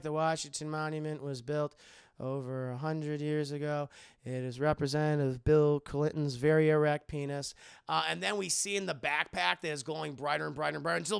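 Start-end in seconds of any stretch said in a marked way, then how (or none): 0.0-7.5 tour guide voice. Retold or imitated. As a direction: recite it like a bored guide. Tour guide reciting facts.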